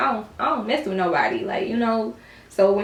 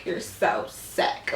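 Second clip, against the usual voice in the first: low, slow, breathy mock quote; mocking imitation; 7 semitones lower, 7 dB quieter, much slower than usual. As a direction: mimic his catchphrase in a hushed drag. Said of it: "why you keep saying you're sick".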